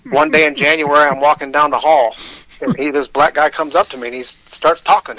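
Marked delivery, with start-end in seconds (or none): none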